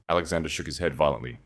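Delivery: ordinary delivery, nothing marked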